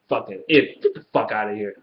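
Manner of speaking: usual speaking voice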